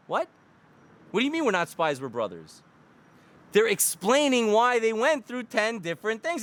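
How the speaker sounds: usual speaking voice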